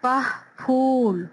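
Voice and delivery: फूल with a puff on the फ, very slow; slow model reading. Shown as a demonstration. Teacher modelling aspirated फूल. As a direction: stretch पह into फूल. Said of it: "पह की sound आ रही है".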